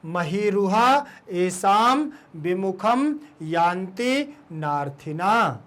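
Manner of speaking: slow, each word drawn out and separate; slow word-by-word reading. Shown as a demonstration. Teacher asks pupils to say it, slow word by word. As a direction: leave a gap after each word for repeating. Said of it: "पढ़िए इसको".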